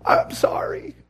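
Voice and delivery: tearful whispered apology; crying. Retold or imitated. Missionary comes out of prayer to his wife, crying. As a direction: whisper it as if through tears, imitating him. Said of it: "he comes out crying".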